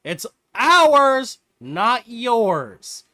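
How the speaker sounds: high, loud, slow protest; indignant call from afar. Acted. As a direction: shout it across the room to stake a claim. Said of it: "a head peek out from a corner saying".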